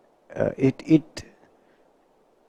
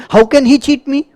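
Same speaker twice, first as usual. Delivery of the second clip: high, loud cry of betrayal; indignant father's outburst; outraged father; 11 semitones higher, 7 dB louder than usual. Acted. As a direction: blurt it out like a cheated man.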